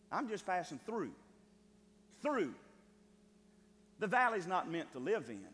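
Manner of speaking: usual speaking voice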